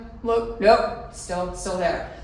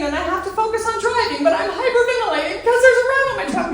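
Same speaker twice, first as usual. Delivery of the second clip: much higher, laughing through panic; 12 semitones higher, 5 dB louder than usual. Real, not acted. Telling listeners about the rat ride, high laughing. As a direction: laugh as you recall panicking.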